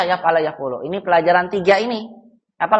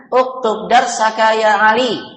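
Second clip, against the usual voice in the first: raised-pitch classroom order; commanding; 4 semitones higher, 3 dB louder than usual. Shown as a demonstration. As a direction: order the pupil firmly. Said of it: "menyuruh atau memerintah".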